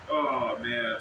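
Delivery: usual speaking voice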